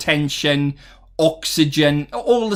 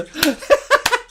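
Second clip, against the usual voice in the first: laugh. Real, not acted. Laughs at chat guessing accent.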